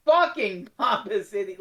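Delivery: ordinary talk, nothing marked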